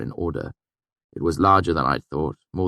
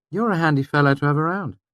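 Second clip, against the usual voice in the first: a hint of a higher, appreciative voice; lightly voiced Denner; 11 semitones higher, 4 dB louder, faster than usual. A narrator's reading. Denner thanking the narrator, lightly voiced. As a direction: praise him fondly.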